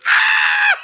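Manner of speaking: very high, piercing scream of terror; terrified scream. Acted. Game master as the fleeing wife, terrified scream. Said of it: "she turns and just runs".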